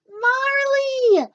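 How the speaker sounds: very high, loud joyful shriek; girl overjoyed to see her dog; delighted shriek. A narrator's reading. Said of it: "Cassie shrieked with delight".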